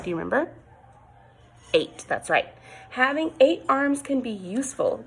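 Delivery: ordinary delivery, nothing marked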